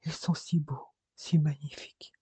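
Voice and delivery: soft, breath-light whisper in the ear; hushed, intimate admiration; tender murmur. A narrator's reading. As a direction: murmur it very softly to a loved one. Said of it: "murmura Robert à son oreille".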